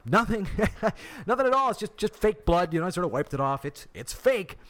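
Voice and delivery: high, laughing, embarrassed denial; sheepish laughing. Retold or imitated. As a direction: brush it off with a nervous laugh. Retold sheepish reply with laugh.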